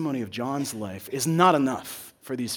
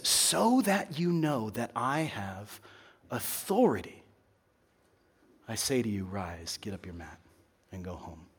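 quiet authority; 6 semitones lower, 7 dB quieter than usual. Acted. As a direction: say it with calm, hushed command. Jesus commands the man to rise.

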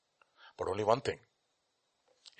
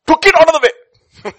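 high, explosive preaching yell; triumphant shout; 20 dB louder than usual. Real, not acted. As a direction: burst out at full force. Preacher erupts at the climax.

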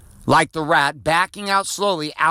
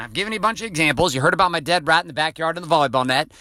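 ordinary delivery

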